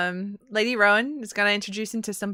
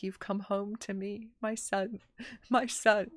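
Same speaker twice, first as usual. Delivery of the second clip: much softer, hushed and loving; tender father; 8 dB quieter, slower than usual. Retold or imitated. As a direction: murmur it while hugging your son. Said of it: "his father was like".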